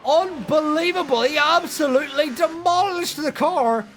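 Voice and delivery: high, loud excited crash call; motorsport commentator. Real, not acted. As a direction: yell it like a huge shunt just happened. Live crash call, safety car.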